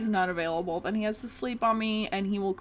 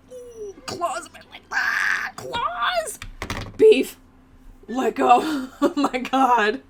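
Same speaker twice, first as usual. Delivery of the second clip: high shriek bursting into loud cries; real loud pained yelp; 5 semitones higher, 11 dB louder, slower than usual. Real, not acted. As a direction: yelp as cat claws dig into your leg. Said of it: "I'm sorry if that was loud".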